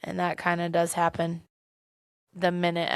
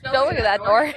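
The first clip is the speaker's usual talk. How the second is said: loud, higher hammed-up deflection; mock alarmed warning; 6 semitones higher, 9 dB louder than usual. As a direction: blurt it out in playful imitation. Hosts act out Ned's panicky deflection.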